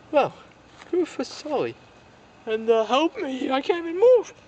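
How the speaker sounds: a stuck little figure begging for help; high pleading toy voice. Acted. Stuck toy asks Sully for help, in a high plea.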